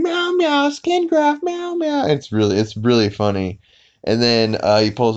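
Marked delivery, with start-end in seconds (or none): 0.0-2.1 very high, squealing cat voice; imitated burning cat. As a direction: yowl the words like a cartoon cat in distress. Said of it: "there's a cat that's on fire".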